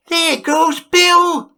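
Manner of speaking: high, slow cheering cry; chorus shout. Acted. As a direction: crowd crying out together. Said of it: "general chorus of".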